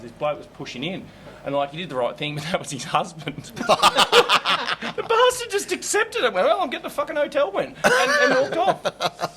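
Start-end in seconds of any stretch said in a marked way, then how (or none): 3.6-9.4 high, loud words squeezed out through laughter; can barely talk for laughing; laughing hard. Real, not acted. Comic finishing the husband story, laughing hard.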